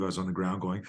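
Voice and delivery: ordinary delivery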